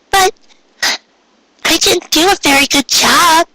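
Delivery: put-on high, small voice of a doubtful young mare; young mare Pinkie. Young mare telling her parents she failed, high and unsure.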